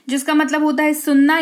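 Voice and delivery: normal talking voice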